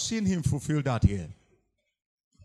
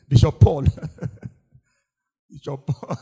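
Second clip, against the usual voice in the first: laughing; 2 semitones lower, 6 dB louder than usual. Real, not acted. Laughs while teasing about Paul.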